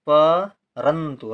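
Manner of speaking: परंतु very slowly, piece by piece; broken into syllables. Shown as a demonstration. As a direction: split परंतु as pa, rantu. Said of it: "ना का उच्चारण हो रहा है बहुत कम समय के लिए".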